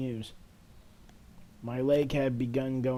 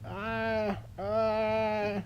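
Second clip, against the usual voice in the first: two high gasps; startled gasps; 9 semitones higher than usual. Acted. Hearing the ghost's cruel words, high startled gasps. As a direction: catch her breath in alarm.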